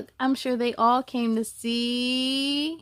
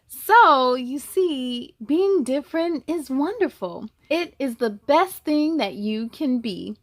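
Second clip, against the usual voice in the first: louder, lively storybook narration; reading to children; 6 dB louder than usual. A narrator's reading. Reading the zebra story to kids, lively and louder. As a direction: tell it brightly to the little ones. Reads the picture book aloud.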